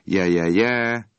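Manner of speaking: slow, stretched-out hawker's call, in an odd voice; drawn-out holler. A narrator's reading. Ice man calling out to the customers, a slow drawn holler. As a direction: sing out the call slowly. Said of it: "kurjantott egyet".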